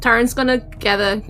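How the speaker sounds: normal talking voice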